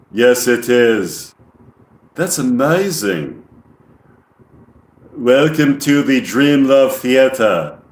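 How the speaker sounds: put-on slow monotone alien, English accent. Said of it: "speak like an alien with an English accent".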